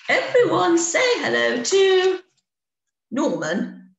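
high and loud head-teacher call; rally the whole class to greet him; Queen bee. A narrator's reading. Queen bee telling pupils to greet Norman, high and loud.

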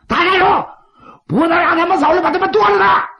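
bloodthirsty crowd screaming kill him; mob shouting. Acted.

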